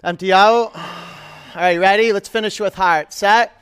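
loud, high coach calls; loud driving cues. Real, not acted. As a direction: push them through the finish. Coach pushing final reps.

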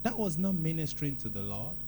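usual speaking voice

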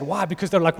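ordinary delivery

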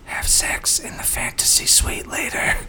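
breathy giggly whisper. Retold or imitated. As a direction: whisper it like a silly crush. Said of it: "childish and silly".

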